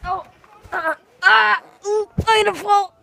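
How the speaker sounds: loud high alarmed shouting; panicked yells. Real, not acted. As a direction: cry out as you topple. Nearly falling off spinning chair.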